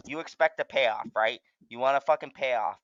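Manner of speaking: ordinary talk, nothing marked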